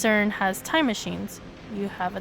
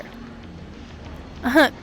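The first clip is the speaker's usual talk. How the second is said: breathy laugh; 3 semitones higher than usual. Real, not acted. Laughs at the forum post.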